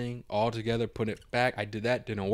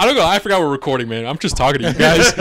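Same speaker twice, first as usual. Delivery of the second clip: laughing; 5 semitones higher, 9 dB louder, faster than usual. Real, not acted. Laughs at forgetting recording.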